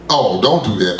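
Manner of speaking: ordinary talk, nothing marked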